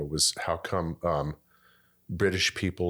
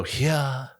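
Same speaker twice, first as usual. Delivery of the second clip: drawn-out British narrator's word; David Richard Attenborough; 7 semitones higher than usual. Retold or imitated. Quoting a famed nature narrator to the host, drawn-out British voice. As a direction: savor it like a nature documentary narrator. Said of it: "once you hear him go".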